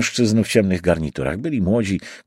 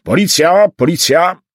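loud, high repeated shout; police shout; 9 semitones higher, 6 dB louder than usual. A narrator's reading. Pursuer announcing police to the crowd, loud shout. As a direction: shout it out as a warning. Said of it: "krzyknął jeden z nich".

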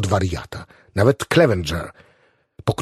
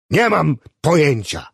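exasperated reply; 5 semitones higher than usual. A narrator's reading. Agitated Clevenger answers in quarrel.

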